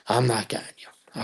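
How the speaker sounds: unvoiced, hushed no; whispered refusal. Mom refusing to pick him up, whispered. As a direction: whisper the brush-off.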